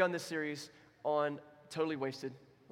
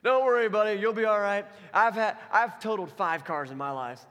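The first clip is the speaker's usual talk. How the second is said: amused reassurance; 5 semitones higher, 10 dB louder than usual. Retold or imitated. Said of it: "laughed under his breath".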